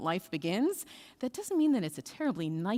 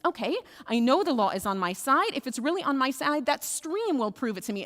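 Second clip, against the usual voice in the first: insistent, exasperated, raised pitch; frustrated; 5 semitones higher, 5 dB louder than usual. Acted. Frustrated rabbi demanding that the stream prove him right, insistent. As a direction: press the point harder. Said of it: "getting really frustrated".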